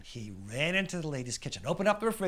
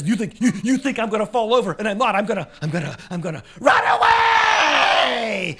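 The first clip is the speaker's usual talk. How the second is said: loud, high stammer building to a long scream; hammed-up terror, breaking into a shout; panicked bluster; 8 semitones higher, 12 dB louder than usual. Policeman panics and flees.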